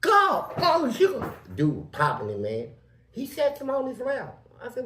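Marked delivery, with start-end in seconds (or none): 0.0-1.2 high, loud hollering; imitated scream. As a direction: scream it out as the man did. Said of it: "And he screamed".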